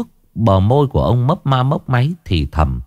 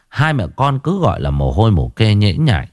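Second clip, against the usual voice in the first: ordinary delivery, nothing marked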